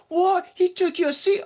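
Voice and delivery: very high put-on voice, incredulous; squeaky mock-foreign disbelief; imitated accent. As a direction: mimic an outraged tourist in a caricatured accent. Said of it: "Oh, yeah, I did it again".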